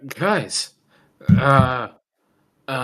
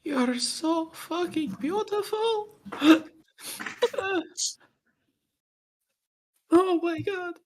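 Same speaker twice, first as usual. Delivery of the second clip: crying; 12 semitones higher than usual. Pretend. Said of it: "guys, I can't stop crying".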